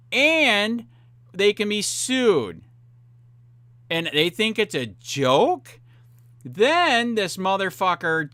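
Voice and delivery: a rant that keeps climbing; rising indignation. Real, not acted. Ranting about being called whack job.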